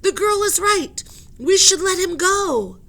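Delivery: high, very loud call for attention; polite shout. Acted. Henry shouts to the captain and professor, high and loud. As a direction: shout over the argument. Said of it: "he shouted".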